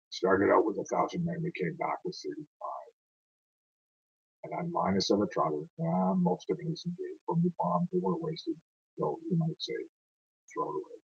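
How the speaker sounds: soft, fading close to a soldier's ballad; hushed recitation of the losses; subdued poem ending. Acted. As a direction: let the verse die away quietly. Poem's subdued closing lines.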